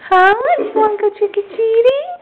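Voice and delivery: high, eager doggy voice; excited pet talk. Real, not acted. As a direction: sing it up to the dog. Asking dog again, higher.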